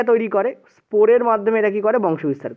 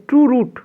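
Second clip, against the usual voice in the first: True said higher and louder, falling; stress on true; 5 semitones higher, 4 dB louder than usual. Shown as a demonstration. Teacher to class, stressing true. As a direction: lean on true in true root. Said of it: "প্রকৃত মূল হয় না".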